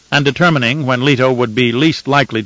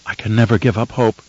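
ordinary delivery

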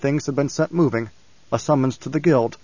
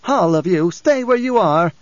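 parody of control; 5 semitones higher, 4 dB louder than usual. A narrator's reading. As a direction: command the room in a mock-authoritative voice. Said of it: "a parody of Bene Gesserit control".

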